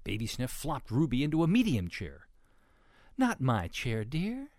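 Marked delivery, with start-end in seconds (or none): 3.2-4.5 restrained, lilting up high on "dear"; snuffing Mama pig. A narrator's reading. Mama pig sees Ruby in her chair, mildly objecting. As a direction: object mildly with a lifted ending. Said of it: "snuffed Mama Sniff".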